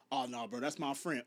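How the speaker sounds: low, soft mock denial from another man; lightly acted guy denying it; imitated guy's reply. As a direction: drop low and quiet, imitating a casual brush-off. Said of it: "come up to you and be like".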